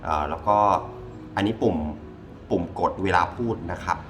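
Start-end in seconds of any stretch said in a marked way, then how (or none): none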